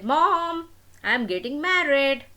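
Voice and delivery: bursting to tell his mom the news; excited announcement. Acted. Son telling his mother he's marrying, excited and loud.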